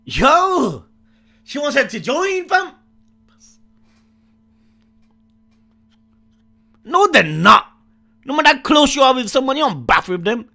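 loud, high, astonished protest; scandalised outburst. Real, not acted. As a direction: protest loudly in shocked disbelief. Scandalised reaction to bathing scene.

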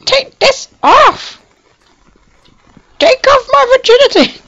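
over-the-top damsel in distress; mock distressed damsel. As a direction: plead dramatically for rescue. Playing distressed character.

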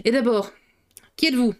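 mildly threatening interrogation; suspicious inspector's challenge; menacing. A narrator's reading. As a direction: demand it curtly, with a pause midway. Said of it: "menaçant".

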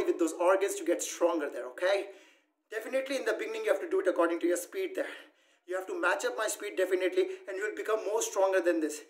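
ordinary delivery

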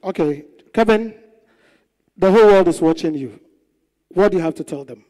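loud MC voice; show host. Acted. Host asking the evicted contestant to speak, loud. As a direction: push the mic at him, loud.

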